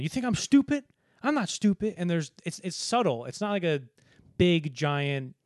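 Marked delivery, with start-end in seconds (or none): 0.0-1.9 high, lightly voiced indignant retort; a hint of the offended Jimmy; imitating Jimmy. Jimmy snapping at Sydney, a light mock indignation. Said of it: "Sam Jackson, is talking to Sydney going".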